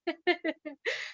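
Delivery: a short, quiet embarrassed laugh; soft laugh. Real, not acted. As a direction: let a small rueful laugh slip out. Laughs after painful memory. (risada curta e contida)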